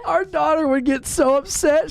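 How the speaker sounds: talking through high laughter; laughter-broken telling; laughing. Real, not acted. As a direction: still cracking up.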